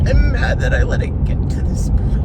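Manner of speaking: breaking down in loud sobs while venting; crying. Real, not acted. Vlogger venting to viewers about her foot injury, crying loudly. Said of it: "I don't mean to cry".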